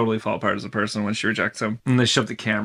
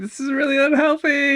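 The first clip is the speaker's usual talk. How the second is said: mock sobbing; 13 semitones higher than usual. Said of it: "her sobbing all the time".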